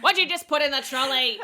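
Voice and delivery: high, quick mock-scolding question; mock scolding mum. Mum catching her kid at the trolley, quick high mock scold. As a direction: tell off the child, fast and high.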